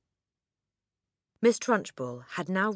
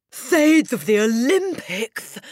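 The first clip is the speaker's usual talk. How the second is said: plain reading voice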